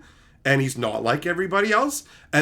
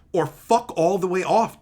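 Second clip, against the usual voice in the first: ordinary talk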